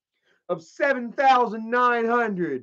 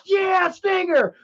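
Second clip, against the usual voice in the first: excited cheer; 9 semitones higher, slower than usual. Retold or imitated. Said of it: "I was like".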